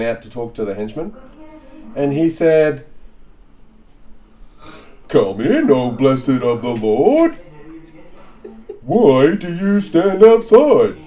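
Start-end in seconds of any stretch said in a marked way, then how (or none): 5.1-11.0 slow, loud, high booming host voice; grand welcome. Acted. Laban greeting the henchman at the door, booming and slow. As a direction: welcome the guest with slow ceremony.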